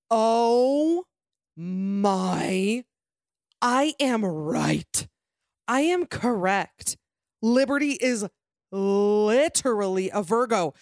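slow, drawn-out amazement; real, drawn-out shock at being right; triumphant disbelief. Real, not acted. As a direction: stretch each phrase in astonished triumph. Astonished her Virgo guess is correct.

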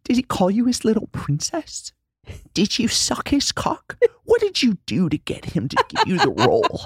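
high, slurred, bitter taunt; Winona Ryder impression. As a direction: taunt her like a drunk rival. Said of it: "Do Winona".

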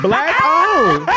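high, loud burst of laughter; laughing. Real, not acted. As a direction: crack up over the words. Laughing at the mispronunciation.

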